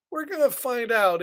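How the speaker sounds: usual speaking voice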